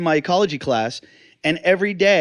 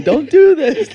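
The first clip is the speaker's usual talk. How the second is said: mock crying; 12 semitones higher, 9 dB louder, slower than usual. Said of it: "he starts crying, going".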